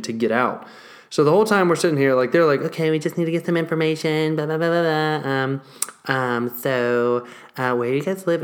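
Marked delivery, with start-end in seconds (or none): 2.6-8.4 lightly voiced bored receptionist; clinic receptionist. A hint of a clinic receptionist asking the owners for details, routine. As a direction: rattle off the routine questions.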